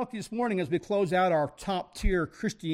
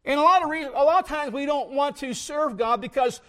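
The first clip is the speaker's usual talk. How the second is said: preaching; 4 semitones higher than usual. Real, not acted. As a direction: preach it with rising fire. Preacher builds to full force.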